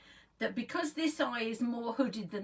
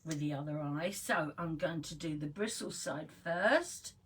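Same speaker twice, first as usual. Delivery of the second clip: ordinary talk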